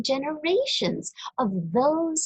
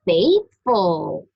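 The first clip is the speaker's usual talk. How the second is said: called out loud and slow; announced title word; 3 semitones lower, 7 dB louder than usual. Acted. Reader to children, announcing the word loudly. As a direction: announce the word Faithful with weight.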